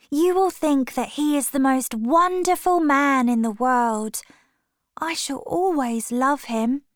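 put-on a girl besotted; gushing young Sybil. A narrator's reading. Sister gushes about lover.

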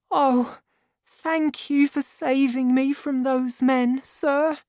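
put-on high, light grateful young woman. A narrator's reading. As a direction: lift the voice for the young heroine.

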